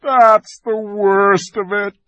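high, slow groaning words. Acted. The fawn groans to Lucy about his task, mild groan. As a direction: let a groan carry the words. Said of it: "with a deep groan".

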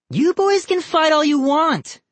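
put-on very high girl's taunting call; calling after. A narrator's reading. Girl to cousins at the front door, calling after them. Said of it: "Molly called after them".

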